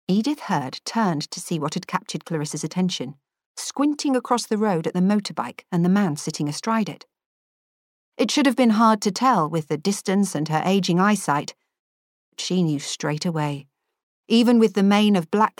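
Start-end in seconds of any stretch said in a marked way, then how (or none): none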